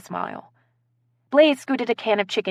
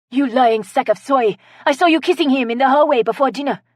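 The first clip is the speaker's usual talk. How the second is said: put-on loud, higher French-accented outburst; French accent, accusing; 7 semitones higher, 6 dB louder than usual. A narrator's reading. Guest jumps up to accuse a rival, French accent. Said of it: "with a French accent".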